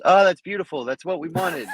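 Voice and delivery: high mock cheer, loud at the start; imitated coach's yell. Judge mimicking coaches on a punch that whiffs, mock yell. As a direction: play a coach overselling a missed punch. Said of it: "Coaches are gonna yell on a punch that whiffs".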